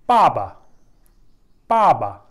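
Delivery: पाबा with a long first "aa", falling on "ba"; drawn-out A. Shown as a demonstration. Teacher modelling the Chinese word for father, long open "aa" in पाबा. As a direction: stretch the first vowel of पाबा, then let it drop. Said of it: "लंबा खींच के मैं ए को पढ़ रहा हूँ".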